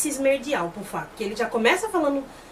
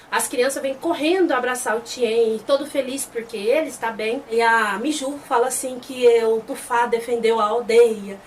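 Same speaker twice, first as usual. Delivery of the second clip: plain reading voice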